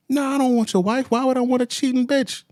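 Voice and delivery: high, incredulous retort; indignant. Acted. Friend's retort in the cheating argument, high and indignant. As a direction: throw the accusation back at him.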